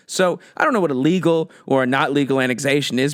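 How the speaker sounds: ordinary talk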